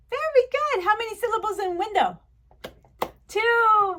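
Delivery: high, loud, upbeat praise and quiz; delighted teacher. Pretend. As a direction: cheer a young pupil on. Praising and quizzing a pupil.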